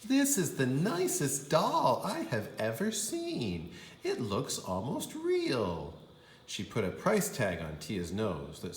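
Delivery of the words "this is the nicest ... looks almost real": higher, delighted voice; lightly voiced charmed shop lady; admiring store lady. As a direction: gush over the doll. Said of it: "The lady said".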